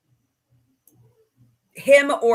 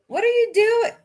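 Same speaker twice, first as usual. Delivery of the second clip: very high singsong question; playful pestering; 13 semitones higher, 4 dB louder than usual. Pretend. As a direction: pipe it up high, teasing. Playfully pestering someone off mic.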